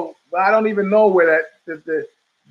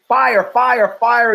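imitating a panicked yell, louder; a theater screamer shouting fire; mock yell; 5 semitones higher, 5 dB louder, much slower than usual. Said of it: "who's been screaming".

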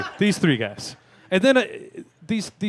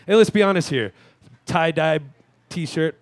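normal talking voice

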